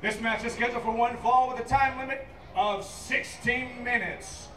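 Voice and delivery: ordinary talk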